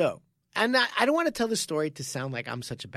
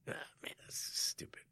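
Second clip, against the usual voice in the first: whispered gripe; 11 dB quieter than usual. Retold or imitated. Training partner whines under breath.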